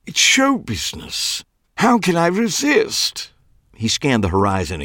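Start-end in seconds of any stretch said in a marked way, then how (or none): 0.1-1.4 high, fading show business; theatrical dandy. A narrator's reading. Clive excusing himself, high sighing show business. 1.8-3.3 theatrical dandy. A narrator's reading. Clive leaning on the car, dramatic high theatrical voice.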